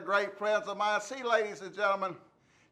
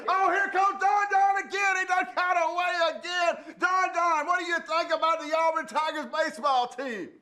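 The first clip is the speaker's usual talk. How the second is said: hammed-up announcement; 8 semitones higher than usual. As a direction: announce the runaway like a ringmaster. Announcing the escaped caller.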